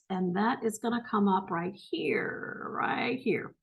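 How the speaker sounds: whispered aside while finding the spot. Real, not acted. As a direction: breathe it to yourself, pointing at the page. Pointing to lines in text.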